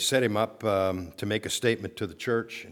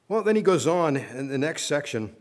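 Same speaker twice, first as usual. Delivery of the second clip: usual speaking voice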